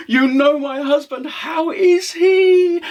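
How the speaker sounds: very high, thrilled character voice; delighted widow. Acted. Widow asking after her husband, delighted. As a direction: soar up with joy, stretching the question. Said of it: "the widow woman was so delighted".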